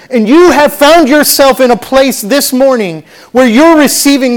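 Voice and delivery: loud, high-pitched pulpit delivery; full-force preaching. Real, not acted. Pastor pressing the gospel on his congregation, full-force preaching.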